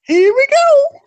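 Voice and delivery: very high, loud cartoon squeak; cartoon plumber yelp; Mario. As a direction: shout it in a tiny high voice. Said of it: "Mario catchphrase".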